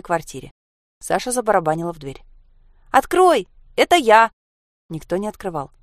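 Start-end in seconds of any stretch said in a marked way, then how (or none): 2.9-4.3 put-on loud, high child's shout; calling through door. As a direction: shout to be let in. Girl shouts through a closed door. (громко кричать через дверь)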